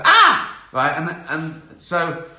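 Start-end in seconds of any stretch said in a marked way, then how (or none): 0.0-0.6 short scream, much higher and louder; mock scream. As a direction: let out a sudden sharp scream. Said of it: "I look, I go".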